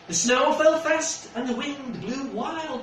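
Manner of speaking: lightly lilting storybook read; gentle read-aloud for a little one; reading to a child. Acted. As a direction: tell it like a bedtime picture book. Reads picture book aloud.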